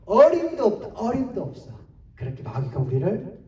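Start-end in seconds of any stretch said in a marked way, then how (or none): 0.1-1.8 higher, louder mock dismissal; acted jeer, jumping up then easing; devil's scoff. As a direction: scoff at them as the taunting devil. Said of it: "그래서 마귀가".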